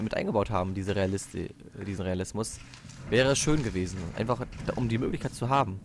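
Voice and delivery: normal talking voice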